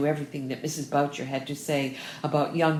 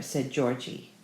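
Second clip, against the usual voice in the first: usual speaking voice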